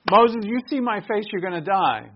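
loud, high mock Pharaoh threat; hammed-up Pharaoh warning Moses; Pharaoh's threat. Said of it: "I wish I could do a different accent".

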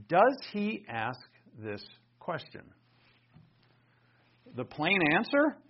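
usual speaking voice

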